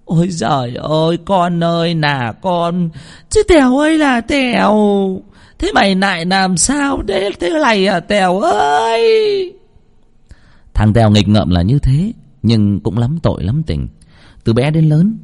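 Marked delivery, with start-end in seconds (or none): 0.0-9.5 high, drawn-out tearful lament; wailing. A narrator's reading. Mother wailing over her unconscious son. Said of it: "Bà Vân mếu máo".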